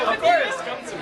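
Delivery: high, loud and sweet; excited high greeting. Real, not acted. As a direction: welcome a fan warmly. Answering fans affectionately.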